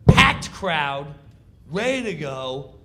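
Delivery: normal talking voice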